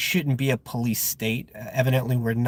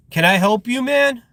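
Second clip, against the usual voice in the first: imitating a confrontational driver, higher and louder; mock-challenge someone staring at you, voice pitched up; challenging question, acted out; retold challenge; 9 semitones higher, 5 dB louder than usual.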